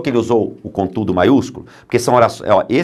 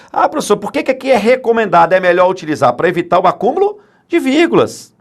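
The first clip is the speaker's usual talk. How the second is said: questioning student; 9 semitones higher, 3 dB louder than usual. Pretend. Imagined student asks the professor.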